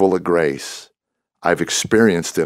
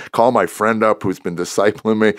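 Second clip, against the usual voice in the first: normal talking voice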